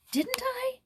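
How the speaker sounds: high, surprised question; surprised she never told him. Acted. Joyce surprised at Jules's question, surprised rise. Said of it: "asked Joyce in surprise".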